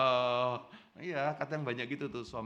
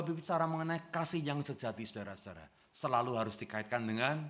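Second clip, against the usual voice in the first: usual speaking voice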